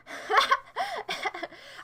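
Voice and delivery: high-pitched burst of laughter; quick high cackling laugh. Real, not acted. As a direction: break into a high giggle. Laughs at own remark.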